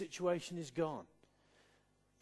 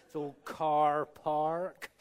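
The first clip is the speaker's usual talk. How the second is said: slow, rolled-R West Country car park; lightly done West Country accent; 6 dB louder, much slower than usual. Shown as a demonstration. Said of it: "you start to roll your R's".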